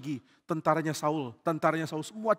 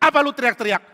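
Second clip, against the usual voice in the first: high, loud comeback; loud, testy challenge; irritated retort; 9 semitones higher, 10 dB louder than usual. Acted. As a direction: shout back, annoyed at being called. Abner membalas teriakan dengan kesal.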